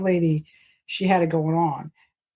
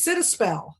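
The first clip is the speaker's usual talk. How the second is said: put-on Southern accent; 5 semitones higher than usual. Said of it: "I gotta get that Southern accent".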